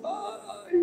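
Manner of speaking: sharp high sigh of despair; high exasperated sigh. Real, not acted. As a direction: sigh at the victim's folly. Gemido de desânimo. (suspiro agudo de desânimo)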